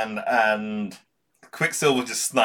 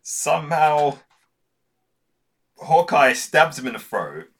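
louder, higher, amazed retelling; a burst of disbelief at the twist; excited; 6 semitones higher, 5 dB louder than usual. Real, not acted. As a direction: sell the surprise of the stab.

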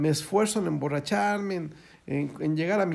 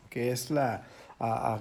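ordinary delivery

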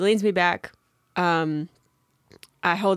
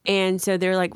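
ordinary talk

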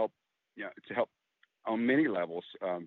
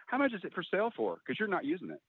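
imitating a sassy mom's jab, pitched higher; teasing mother; 8 semitones higher than usual. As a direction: mimic Mom needling him with a dry jab.

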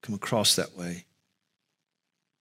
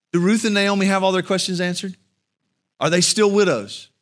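higher, louder, fast rhetorical questions; preaching; 8 semitones higher, 5 dB louder than usual. Acted. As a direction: drive the questions home from the pulpit. Preaching at full force.

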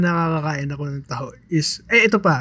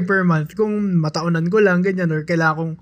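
normal talking voice

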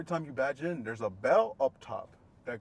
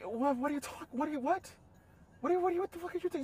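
high-pitched, stammering bafflement; flustered disbelief; much faster than usual. Retold or imitated. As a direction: stumble over it in acted disbelief. Acted flustered reply in quarrel.

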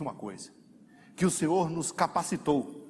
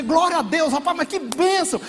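higher and louder, overjoyed; joyful exclamation; 13 semitones higher, 8 dB louder than usual. Retold or imitated. Believer overjoyed to embrace the one he forgave. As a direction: greet him like a long-lost brother. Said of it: "você quer dizer".

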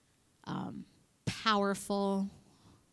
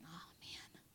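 whispered mock sigh of words; hushed relief; 3 semitones lower, 20 dB quieter than usual. The corrected child reacting afterwards, hushed. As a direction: breathe it out in a whisper.

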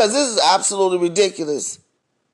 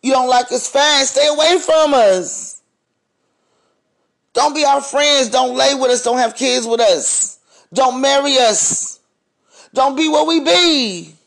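rising, higher, forceful telling-off; heated rant; 5 semitones higher than usual. Real, not acted. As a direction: lay down the law, voice climbing.

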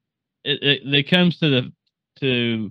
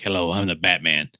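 a hint of a low hero voice; lightly voiced Batman; 6 semitones lower than usual. Retelling Kevin Bacon introducing himself as Batman, lightly voiced hero. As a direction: drop the pitch slightly for a mock Batman.

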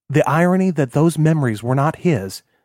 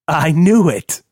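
louder, eager realization; excited outburst; 4 semitones higher, 5 dB louder, slower than usual. Acted. Kilroy realizing who Jonathan married, excited. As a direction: blurt it out as it clicks.

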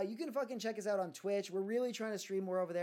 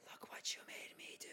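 eerie, hushed whisper; imitated creepy movie whisper; ghostly gray woman; 13 dB quieter than usual. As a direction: whisper it like a horror-film apparition. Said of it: "there's that gray woman, and she's like".

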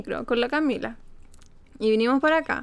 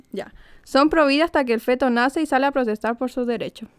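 normal talking voice

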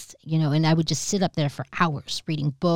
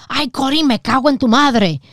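mom's louder Spanish scolding; 7 semitones higher, 5 dB louder than usual. Retold or imitated. Mother yelling at her daughter, a louder scold. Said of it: "my mom would be like".

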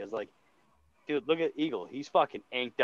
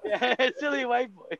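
slow, higher laughing mockery; laughing taunt; 8 semitones higher, slower than usual. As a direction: chuckle it out high and slow.

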